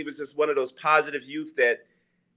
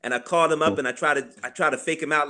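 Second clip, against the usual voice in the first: ordinary delivery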